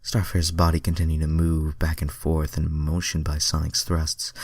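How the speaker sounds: usual speaking voice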